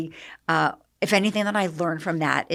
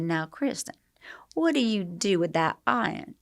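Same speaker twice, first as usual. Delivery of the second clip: Southern hostess; 4 dB quieter than usual. Retold or imitated. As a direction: imitate a genteel Southern TV hostess. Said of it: "she talked like this".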